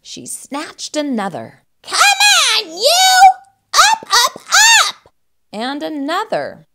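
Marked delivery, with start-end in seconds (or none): 1.8-5.1 very high, loud shout; bossy, punchy high yell; impatient yelling. Acted. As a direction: bark it to get someone out of bed. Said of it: "she yelled".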